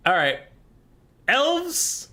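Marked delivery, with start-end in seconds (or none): 1.3-2.1 mock surprise. Real, not acted. Mock disbelief at the elf book.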